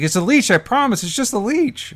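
loud, very high-pitched pleading; frantic mock assurance. Lover swears it is only a leech, in mock panic. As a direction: insist desperately, hammed up. Acted pleading in the horrified scene.

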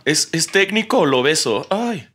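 high, slow, dreamy lovestruck sighing; swooning referee. Retold or imitated. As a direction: sigh it out like a smitten teenager. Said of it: "el árbitro se puso nervioso y dijo".

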